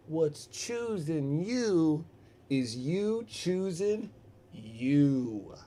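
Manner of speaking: very slow, drawn-out delivery; slow, portentous stranger's voice; mock-profound riddle. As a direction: stretch each word like deep wisdom.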